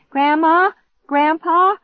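high, loud frantic call; yelling. A narrator's reading. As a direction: yell through the house for help. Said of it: "I yelled".

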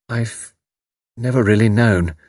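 halting, thoughtful answer; slow, hesitant. Acted. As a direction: pause after the first word, unsure. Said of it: "She said slowly".

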